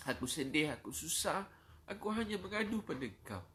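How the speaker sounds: high, pleading cry to God; sorrowful supplication. Shown as a demonstration. Preacher showing listeners how to pray when sad, sorrowful high plea. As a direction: repeat it as an imploring lament, voice lifted. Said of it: "Kalau tuan-puan rasa sedih, susah, cakap".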